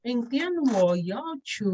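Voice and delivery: 明天我要去 with each tone rising or falling distinctly; Mandarin tone demonstration. Shown as a demonstration. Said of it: "pronounce the particular word correctly".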